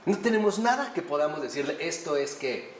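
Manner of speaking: ordinary talk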